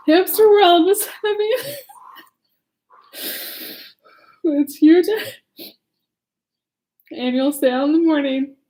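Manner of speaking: trying to read but laughing; reading through giggles. Real, not acted. Teacher reads the story, giggling throughout. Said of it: "I'm so sorry".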